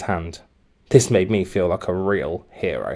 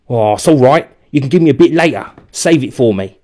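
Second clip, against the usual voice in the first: put-on loud, fast cheerful kid voice; breezy bully; 3 semitones higher, 7 dB louder than usual. A narrator's reading. Gamble leaving the donut for later, breezy and loud. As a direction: toss it off casually as you bounce away.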